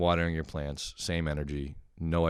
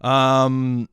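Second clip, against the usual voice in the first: long, loud, held UM; drawn-out filler; 7 semitones higher, 11 dB louder than usual. Shown as a demonstration. Vlogger mocking his own topic-change habit, drawn-out UM. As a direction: stretch out UM on a raised, steady note. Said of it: "every time I change the topic in this fucking thing, I go".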